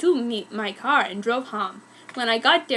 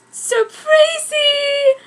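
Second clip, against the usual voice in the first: very high, slow stretched shout; drawn-out shout; 13 semitones higher, 6 dB louder, much slower than usual. Acted. Family shouts surprise at the wedding, drawn-out high shout. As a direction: stretch it out like a party yell. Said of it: "they all shouted".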